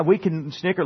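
normal talking voice

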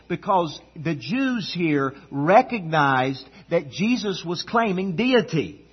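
ordinary delivery

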